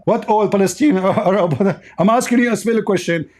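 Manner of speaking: ordinary delivery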